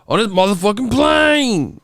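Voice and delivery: impression of an actor raging at snakes; Samuel Jackson impression. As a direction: belt it like Samuel Jackson fed up. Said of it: "you know Samuel Jackson, he said".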